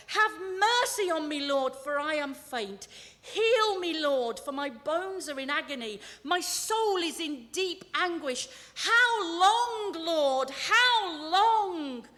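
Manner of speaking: anguished psalm plea, high and loud; crying out to God. Acted. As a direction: swell to loud, drawn-out appeals. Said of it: "David cries out to God".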